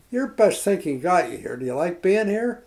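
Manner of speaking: mock tirade at a drunk in bed; retold angry lecture. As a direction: hammer it home like a man out of patience. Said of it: "he just lost his temper completely".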